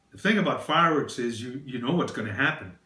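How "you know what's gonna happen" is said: ordinary talk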